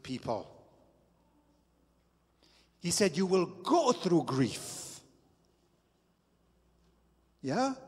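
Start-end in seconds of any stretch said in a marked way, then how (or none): 3.2-5.0 slow, raised preaching voice; earnest pulpit emphasis; preaching quote. Acted. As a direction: lean into the quote.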